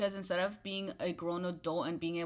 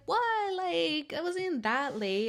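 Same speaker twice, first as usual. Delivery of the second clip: high-pitched, stretched mock protest; mocking, incredulous coworker voice; mimicking dismissive coworker; 11 semitones higher, 5 dB louder, slower than usual. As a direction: imitate her playing it off, high and drawn out. Imitates coworker brushing it off.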